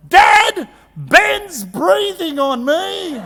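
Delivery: put-on loud, high, drawn-out kid's complaint; yelling daughter. Daughter tattling on her brother to dad in the car, yelling. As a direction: yell it out like an aggrieved child, stretching the words. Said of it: "my daughter yells out".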